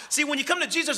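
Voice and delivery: ordinary talk, nothing marked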